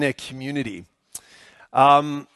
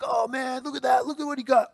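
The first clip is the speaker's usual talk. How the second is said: high-pitched mock amazement; imitating a thrilled young movie fan; imitated excitement; 8 semitones higher, 4 dB quieter than usual. Kids thrilled by action movies.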